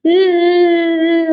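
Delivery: loud whimpering sobs; acted childish crying hums; sobbing. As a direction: sob like a hurt child. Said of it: "எதுக்கு இப்படி அழுதுட்டு இருக்க".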